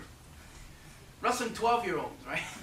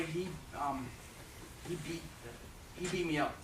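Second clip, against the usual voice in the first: normal talking voice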